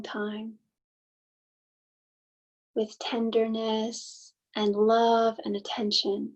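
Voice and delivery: unhurried meditation guide, long pauses between phrases; guided meditation. Acted. Host leading listeners to soften and settle, guided meditation. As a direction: gently guide listeners inward, leaving space after each thought.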